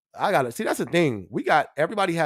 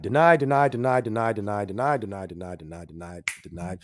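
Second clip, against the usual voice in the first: mock rejection run; 6 semitones lower than usual. Streamer mimics lenders rejecting people, fading mock refusals. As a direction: repeat it like a stamp, steadily dropping away. Acts out repeated credit rejections.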